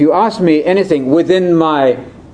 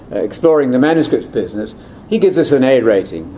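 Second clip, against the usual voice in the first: ordinary talk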